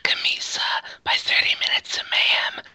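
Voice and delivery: exhaling breathy ad whisper. Acted. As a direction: breathe the words into the mic. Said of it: "exhaling into the mic".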